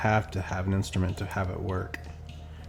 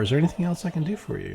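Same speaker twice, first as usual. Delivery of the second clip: quick and higher, halfway out the door; doctor rushing at the end of the visit, fast and higher; rushed; 6 semitones higher, faster than usual. Shown as a demonstration. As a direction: hurry the question, already reaching for the door. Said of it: "reaching for the door".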